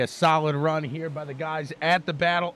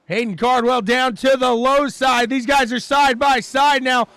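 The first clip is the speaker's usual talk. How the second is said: loud, high race call at full energy; excited play-by-play; 9 semitones higher, 7 dB louder than usual. Real, not acted. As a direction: drive it higher and louder as they race side by side.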